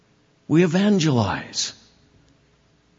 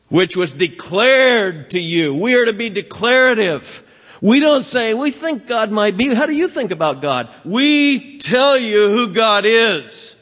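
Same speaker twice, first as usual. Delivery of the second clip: higher and louder, proclaiming with authority; full force preaching; 7 semitones higher, 5 dB louder than usual. Acted. Preacher declaring God's word to congregation, loud and high. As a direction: declare it, no hedging.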